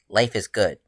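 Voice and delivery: usual speaking voice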